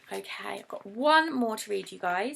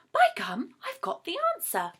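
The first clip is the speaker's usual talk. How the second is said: louder, rising yell of discovery; excited yell; 8 semitones higher, 5 dB louder than usual. Acted. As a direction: shout out the eureka moment.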